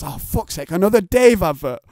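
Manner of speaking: fed up, rising to a loud complaint; annoyed viewer moaning about ads; mock exasperation.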